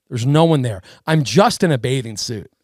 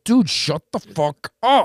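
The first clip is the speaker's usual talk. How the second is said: slow, pitched-up "shut the fuck up"; fed-up retort; 5 semitones higher, slower than usual. Retold or imitated. Guest to the lecturing cop, slow and fed up. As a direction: drag it out, annoyed. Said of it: "I'm going".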